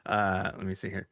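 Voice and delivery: normal talking voice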